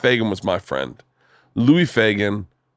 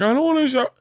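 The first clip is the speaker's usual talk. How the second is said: put-on much higher whiny little girl; whiny kid; faster than usual. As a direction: whine it like a child refusing. Daughter whining, voiced much higher.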